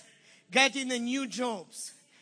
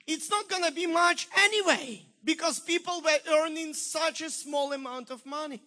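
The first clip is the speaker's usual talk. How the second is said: ordinary delivery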